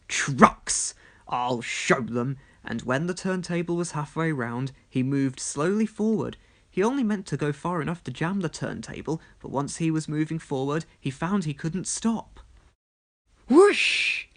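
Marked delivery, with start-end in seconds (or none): 0.1-2.4 a hissed threat, dragged out slow; loud angry hiss. Acted. Gordon vowing revenge on the trucks, hissed. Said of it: "Gordon hissed loudly". 13.5-14.3 high, loud whoosh; a high yelp as he flies off; whooshing cry. Acted. Engine sliding off the rails, high loud whoosh.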